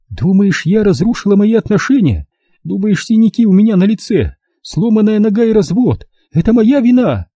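high, agitated string of questions; wounded outburst. Acted. Mother hurt by daughter's accusation, wounded outburst. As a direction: challenge her with rising agitation, pitch high.

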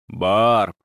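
low, distinct call of a name; deep voice calling out of the dark; a mysterious low summons; voice in the night. A narrator's reading. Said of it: "вновь услышала голос".

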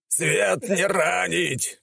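high, stretched hero cry; hammed-up high-pitched battle cry; defiant warrior retort. A narrator's reading. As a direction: squeeze it out high and slow. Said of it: "парировал Святогор".

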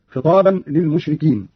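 ordinary talk, nothing marked

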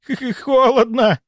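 high, drawn-out stutter with shivers; teeth chattering. A narrator's reading. Feverish patient complaining of cold, teeth chattering. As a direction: stammer through chattering teeth. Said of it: "стучал он зубами".

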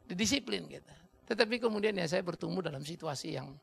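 normal talking voice

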